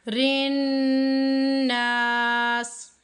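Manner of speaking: नास drawn out long; slow recitation. Shown as a demonstration. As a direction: stretch नास, not नस. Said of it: "अब इसको नस नहीं पढ़ देना". (नास को खींच कर)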